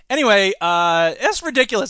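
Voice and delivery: ordinary talk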